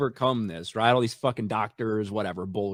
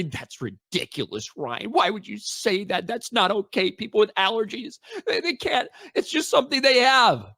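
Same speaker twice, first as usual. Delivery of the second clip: much higher, whiny mock of the viewers; mocking chat critics; 8 semitones higher than usual. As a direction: parody the offended commenters in a high whine. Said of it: "a lot of you guys told me".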